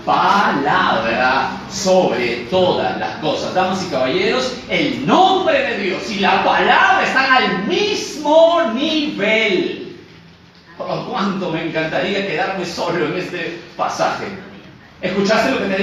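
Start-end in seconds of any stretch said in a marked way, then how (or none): 0.0-10.0 loud, drawn-out sermon emphasis; preaching at full force. Real, not acted. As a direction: proclaim it to the whole congregation.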